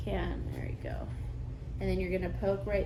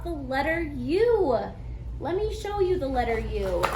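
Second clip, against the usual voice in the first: put-on kids teacher voice; 3 semitones higher than usual. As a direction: announce it to the class. Teacher addressing class on video.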